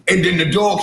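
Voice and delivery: usual speaking voice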